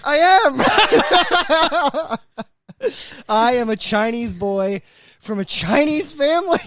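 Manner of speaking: high, loud quote broken by laughter; laughing retelling. Retold or imitated. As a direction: retell it cracking up. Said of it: "and he goes".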